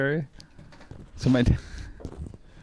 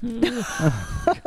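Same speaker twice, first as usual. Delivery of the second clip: groan; 11 semitones higher than usual. Retold or imitated. Kids groaning at mom.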